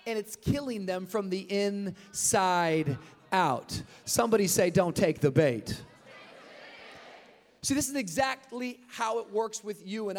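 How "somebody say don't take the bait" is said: call and response. Real, not acted. Preacher calls for response.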